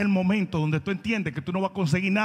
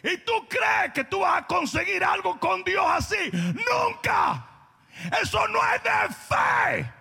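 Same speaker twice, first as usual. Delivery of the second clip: a pastor thundering against self-pity; fiery preaching; 6 semitones higher than usual. Acted. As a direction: drive the point home to the church. Preaching at full force.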